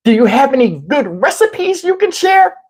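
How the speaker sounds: high, mocking sugary request; irritating woman. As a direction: ask in an annoying lady's high voice. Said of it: "voice to people that irritate me".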